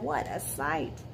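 ordinary delivery, nothing marked

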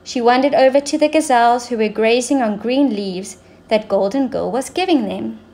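ordinary delivery, nothing marked

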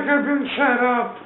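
high, loud, stretched-out wail; mock protest. Charlie on the ground, slow high mock protest. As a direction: draw out his complaint in a hammed-up high voice.

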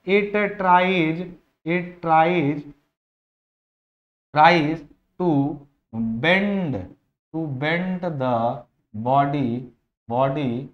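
very slow, word-by-word dictation with pauses. Shown as a demonstration. Teacher spelling out a definition for students, slow dictation. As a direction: dictate it piece by piece for note-taking.

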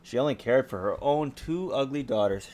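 lively storybook reading; story narration. A narrator's reading. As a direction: read it like a bedtime story. Reads fairy tale to child.

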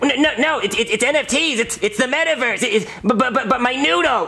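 high, stammering desperation; panicked protest. Acted. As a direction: stammer out a frantic objection. Protests being committed to facility.

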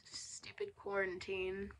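under-the-breath gripe; whispered grumble. Real, not acted. Grumbling about quarantine, under the breath. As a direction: hiss it as a fed-up aside. Annoyed aside about missing farewell.

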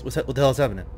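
ordinary delivery

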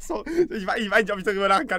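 laughing. Real, not acted. Streamer laughing while talking.

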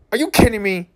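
ordinary talk, nothing marked

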